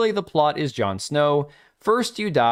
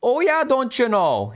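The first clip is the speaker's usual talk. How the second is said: much higher, slow, hammed-up Fargo Wisconsin accent; 12 semitones higher, 3 dB louder than usual. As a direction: draw it out like a Fargo character. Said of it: "like a Fargo character".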